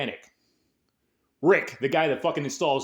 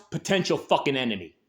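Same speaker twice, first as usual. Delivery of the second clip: normal talking voice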